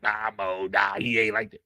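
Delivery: low, mocking voice of the doubters; mock the people who doubted Drew. Host mocks doubters saying Drew ain't like that, low mocking voice. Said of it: "Y'all were like".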